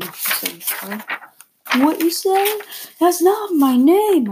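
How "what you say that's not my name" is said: high, loud, slow scream. Acted. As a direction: scream it out in fury. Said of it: "I screamed".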